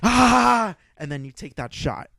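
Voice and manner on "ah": high, loud, slow war cry; battle scream. Shown as a demonstration. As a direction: scream it like a warrior. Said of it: "you scream Valhalla".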